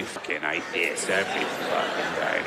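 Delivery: low muttered insult; low sneering zealot. Retold or imitated. Fundamentalist in hell, low muttered sneer. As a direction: grumble it low and bored.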